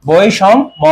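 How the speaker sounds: বৈষম্য slowly as "boi-shom-mo"; slow, split বৈষম্য with sh in the middle; correct sh, broken up. Shown as a demonstration. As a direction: spell out বৈষম্য by sound, drawn out. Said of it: "তালব্য স হবে".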